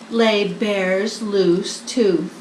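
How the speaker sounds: plain reading voice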